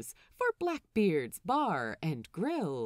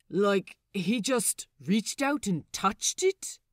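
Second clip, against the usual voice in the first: Wee John asks; 4 dB louder than usual. Acted. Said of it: "Wee John asks".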